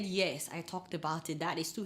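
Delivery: low, soft conceding reply; imitated sheepish agreement, voice dropped; subdued admission. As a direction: concede meekly to your friend. Acts her subdued admission to friend.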